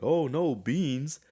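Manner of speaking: hammed-up childish fright; mock fear. Kid faking fear of beans on TV. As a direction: pretend to be scared of the beans. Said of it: "pretended to be afraid of it".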